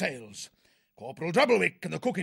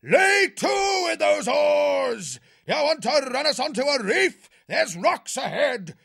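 berating the crew; 5 dB louder than usual. A narrator's reading. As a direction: bawl out the hapless rowers. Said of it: "Cuthbert started berating his hapless crew".

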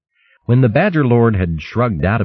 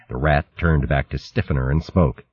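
usual speaking voice

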